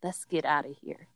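a hint of a low murmur into his ear; soft, close come-on, lightly done; mock ear whisper. Telling the club guy close in his ear, low and soft. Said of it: "I tell him in his ear".

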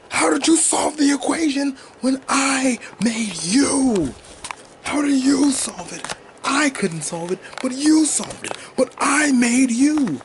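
high-pitched anguished villain shouting, drawn out; High Evolutionary. Acted. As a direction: wail in outrage at your own creation. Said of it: "High Evolutionary is a fucking asshole".

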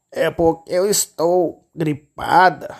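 slow, drawn-out sly wolf voice; wolf answering with false sweetness; wolf as grandma. Acted. Lobo disfarçado. (lobo disfarçado, fala arrastada)

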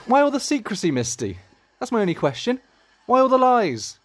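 high, loud demanding questions; indignant. Acted. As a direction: demand the truth, outraged. Confronting Misty about lies.

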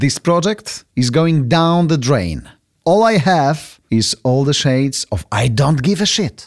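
each word slow and clear; slow deliberate repeat. Shown as a demonstration. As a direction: read it out slowly, savouring it. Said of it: "I aż powtórzę".

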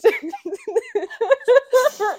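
loud high burst of laughter. Real, not acted. Cohost amused at the hoax, loud high laugh.

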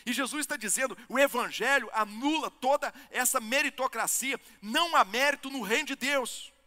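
high, loud, impassioned preacher; full-force preaching. Acted. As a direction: drive it home to the congregation.

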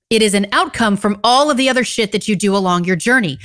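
normal talking voice

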